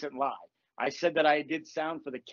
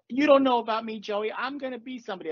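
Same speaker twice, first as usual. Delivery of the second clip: higher, louder scene partner pleading; mock dramatic woman; 6 semitones higher, 3 dB louder than usual. Improv scene partner confronting Joey, mock dramatic. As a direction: play the actress's big line in a hint of a woman's voice. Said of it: "the woman was like".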